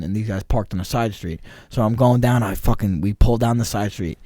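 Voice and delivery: normal talking voice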